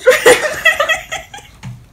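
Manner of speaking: laughing. Real, not acted. Laughing fit.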